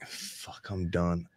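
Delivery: whispered, worn-out sigh of defeat; exhausted mutter. Retold or imitated. Worn out after a long shift, a soft whispered groan. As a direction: breathe it out, spent. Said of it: "you're just like".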